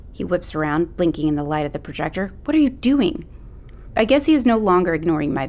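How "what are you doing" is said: high, alarmed teacher demand. Acted. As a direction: catch her in the act. Teacher catching Macy vandalizing.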